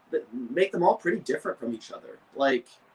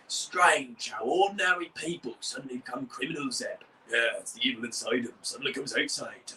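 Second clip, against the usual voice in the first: whispered, sinister character voice; creepy low whisper; Zack; 3 semitones lower, 3 dB quieter than usual. Shown as a demonstration. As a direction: half-whisper it like a lurking villain. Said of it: "Zack sounds something like this".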